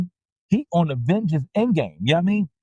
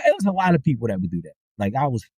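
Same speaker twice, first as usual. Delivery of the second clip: normal talking voice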